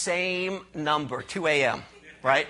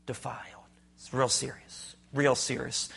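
a whispered, much softer word; 6 semitones lower, 6 dB quieter than usual. Acted. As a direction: drop to a hush on defiled. Preacher hushes a key word.

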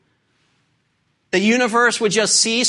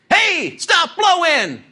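slow, higher mock command at the wind; mock rebuke; 7 semitones higher, much slower than usual. Preacher mock-rebuking the windstorm, slow hammed-up command. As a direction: pretend to scold the wind, hammed-up.

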